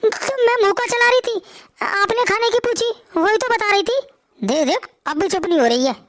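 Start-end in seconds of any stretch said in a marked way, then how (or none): 0.0-4.0 higher-pitched timid wife voice; pleading young wife. Acted. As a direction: plead your innocence, hurt. (ऊँची आवाज़ में सहमी बहू)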